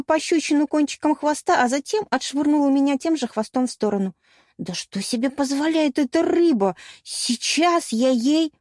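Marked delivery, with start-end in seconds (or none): 4.6-8.5 higher, fuming indignation; indignant outburst. Acted. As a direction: bristle with outrage, stretching the threat. Narrator's indignant inner outburst at mermaid. (возмущённая вспышка)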